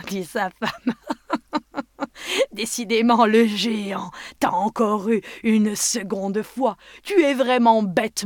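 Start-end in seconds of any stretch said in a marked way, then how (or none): 1.0-2.5 mocking cackle. Wife mocks Job. 2.5-8.2 scolding wife. Acted.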